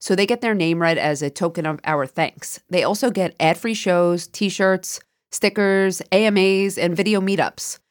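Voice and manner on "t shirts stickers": normal talking voice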